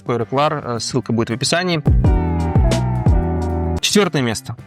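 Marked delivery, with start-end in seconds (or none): none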